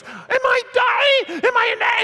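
very high, loud panicked shriek; freaking out. Retold or imitated. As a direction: scream it like a terrified woman in labor. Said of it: "she starts freaking out".

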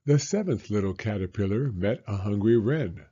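ordinary delivery, nothing marked

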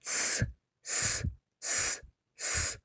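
hard firm hissing s like a tyre pump; firm, hard s sound. Shown as a demonstration. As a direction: hiss it out like a proud pump. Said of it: "Насос від гордовитості свистів".

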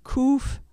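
Kuf with a hard throaty k; Hebrew letter Kuf. Shown as a demonstration. Teacher modelling Kuf for learners to repeat. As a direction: say Kuf slowly for the class to echo. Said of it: "Repeat after me".

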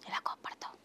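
breathy whispered question; whispered teasing prompt. Shown as a demonstration. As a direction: drop to a hushed whisper to tease. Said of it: "Isha కోపం వస్తే ఎలా చేస్తుంది".

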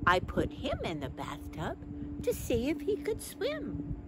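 usual speaking voice

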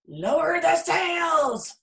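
high, very slow shout; captain shouting orders; storm shout. A narrator's reading. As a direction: order the crew over the storm. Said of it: "Braidbeard shouted".

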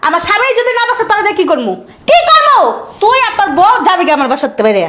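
high-pitched, heated telling-off; furious mother at the end of her patience; angry scolding. Acted. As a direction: lay into your son, voice climbing. Mother rows with her grown son.

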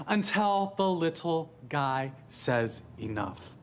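slow dramatic. Acted. Building speech for the little guy.

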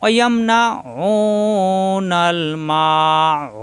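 slow, drawn-out chanted recitation; Quran recitation. Shown as a demonstration. Teacher reciting Quran verse for students, slow chant. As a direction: recite with long tajweed stretches.

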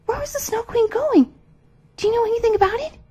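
put-on very high, urgent girl's voice; anxious Gerda. A narrator's reading. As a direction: press them with high-pitched questions. Gerda urgently questions the pigeons.

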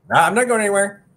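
high, loud playful protest; co-host calling back from off mic, loud and playful. Real, not acted. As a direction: shout back cheerfully from off mic. Co-host calls back in reply.

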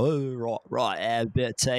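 put-on British bloke; mock English accent on every word. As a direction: caricature British tea talker. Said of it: "some British guy who's talking".

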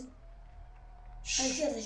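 slow whispered direction; whispered tip. Real, not acted. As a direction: hush it so the killer won't hear. Hushed directions to teammate.

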